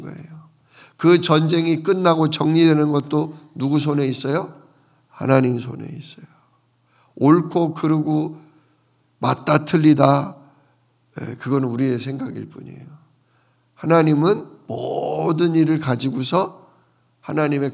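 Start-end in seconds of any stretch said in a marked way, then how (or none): none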